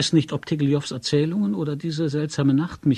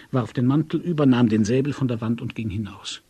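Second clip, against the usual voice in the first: ordinary delivery, nothing marked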